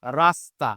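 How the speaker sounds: রাস্তা said 'ras-ta' with a hissed s; s, not sh, in রাস্তা; dental s pronunciation. Shown as a demonstration. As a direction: model a clear s before t in রাস্তা. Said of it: "এর উচ্চারণ আর তালব্য স হবে না".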